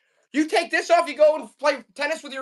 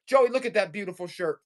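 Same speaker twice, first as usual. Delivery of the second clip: ordinary talk, nothing marked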